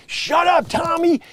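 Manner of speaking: put-on high, loud snap at a child; scolding parent. Parent scolds kid at a restaurant, loud and high. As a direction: yell it like a fed-up parent.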